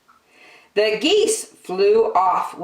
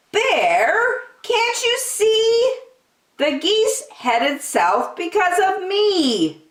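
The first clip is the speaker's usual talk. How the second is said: much higher, airy wind voice; 14 semitones higher than usual. Acted. The wind teasing Big Bear, much higher.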